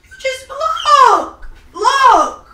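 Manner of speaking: loud, drawn-out tearful wail; crying. Real, not acted. Gamer showing sibling the thrown console, crying wail. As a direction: wail it slowly in distress. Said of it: "So why you crying?".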